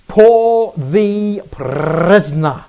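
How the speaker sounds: very slow, loud PRISONER with each word stretched out; drawn-out proclamation. Shown as a demonstration. Preacher proclaims Paul the prisoner to the congregation, drawn-out proclamation. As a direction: proclaim it grandly, lingering on every word.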